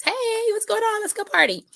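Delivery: put-on high, bouncy party-girl voice; perky party eye. Host voices her lined eye inviting everyone out, perky and high.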